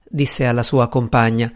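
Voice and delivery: ordinary delivery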